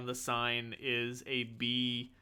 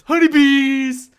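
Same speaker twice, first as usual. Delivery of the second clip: HONEYBEES yelled high, loud and drawn out; over-the-top high yell on "honeybees"; shouting like a moron; 12 semitones higher, 18 dB louder, slower than usual. Shown as a demonstration. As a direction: shout "honeybees" slowly at full volume. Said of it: "shouting it like a moron".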